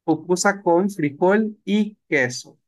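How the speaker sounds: slow and deliberate; glossing tixtipuye for the class; slow translation. Shown as a demonstration. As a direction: lay out pupusa con frijol y queso word by word. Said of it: "le decimos tixtipuye".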